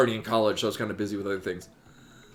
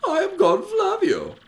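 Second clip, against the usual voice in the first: high squeaky merchant voice; high falsetto character voice; 5 dB louder than usual. Acted. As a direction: play a boastful rich trader. Voicing Don Flavio falsetto.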